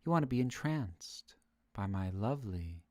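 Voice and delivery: low, soft, close-to-the-mic murmur; mock ASMR. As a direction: play at lulling them, hushed and low, with pauses. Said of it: "talk a little bit lower and turn this into an A S M R channel".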